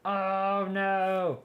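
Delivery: high, loud wailing oh no; anguished wail. Real, not acted. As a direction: cry out in real dismay. Game crashed, real dismay.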